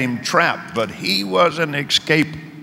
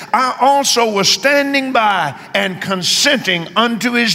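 raised, slow preaching voice; sermon cadence lifted high and drawn out; preaching quote; 6 semitones higher than usual. Acted. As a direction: proclaim the scripture with fervour. Preacher quoting Paul's testimony.

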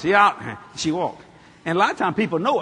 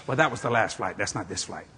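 usual speaking voice

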